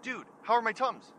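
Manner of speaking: urgent question. Retold or imitated. Calling friend back about Tums.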